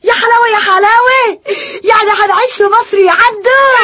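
high thrilled yelps; excited cry. Acted. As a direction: squeal with delight at the news. Wife thrilled about moving to Cairo.

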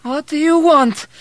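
very high, loud demand; demanding old woman. A narrator's reading. Old woman roused from her memories, demanding. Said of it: "she demanded".

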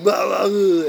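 loud wordless action-star bellow; Schwarzenegger. Acted. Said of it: "With Schwarzenegger, you can just".

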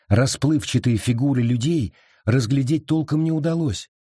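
plain reading voice

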